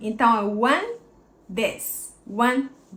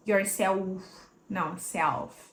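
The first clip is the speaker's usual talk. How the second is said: normal talking voice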